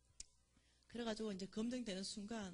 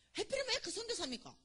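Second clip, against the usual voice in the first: indignant complaint; 12 semitones higher than usual. Retold or imitated. Complaining to God.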